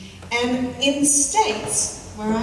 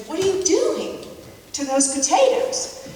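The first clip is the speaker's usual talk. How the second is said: higher, louder mock horror; imitating a husband aghast at the cooking; 8 semitones higher, 4 dB louder than usual. Husband walking in on the mashed potatoes, mock aghast. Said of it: "he was aghast".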